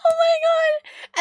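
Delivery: high, drawn-out squeal through real laughter; laughing too hard to talk; helpless laughter. Real, not acted. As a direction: gasp it out while cracking up. Helpless laughter after her story.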